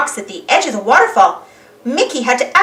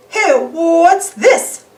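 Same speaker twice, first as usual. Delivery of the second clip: slow, lightly voiced big-guy surprise; a gruff cartoon pal discovering something; surprised Pete; 6 semitones higher, 3 dB louder, much slower than usual. Pete finds an invitation.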